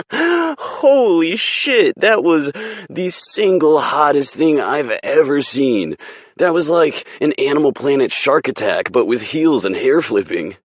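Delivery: high, loud, laughing awe; thrilled gushing. Acted. Langley raves to Rachel about the fight, loud and thrilled. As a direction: gush like you saw a miracle.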